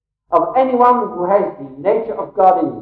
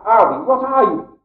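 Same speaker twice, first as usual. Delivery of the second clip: ordinary talk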